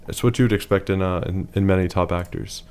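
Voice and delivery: ordinary talk, nothing marked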